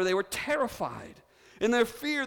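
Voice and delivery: ordinary talk, nothing marked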